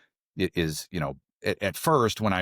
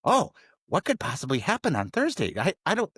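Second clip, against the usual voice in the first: mock wondering; 9 semitones higher than usual. His own mock-curious thought about Thursday.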